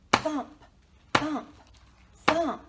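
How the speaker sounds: punchy, separated thumps; loud storybook knocking effect; loud thumping. A narrator's reading. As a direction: pound out each word like a knock. Narrator imitates knocking.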